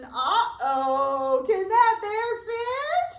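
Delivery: playful alarm, will it fit; worried excitement. Pretend. Teacher wondering if the bear fits, loud sing-song. Bear squeezes into full mitten.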